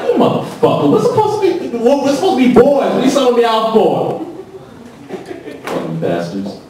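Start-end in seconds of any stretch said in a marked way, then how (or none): 0.0-4.2 much higher, squeaky outraged pleading; betrayed brother. Retold or imitated. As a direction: plead like a kid sold out by his buddy. Brothers caught him, voiced.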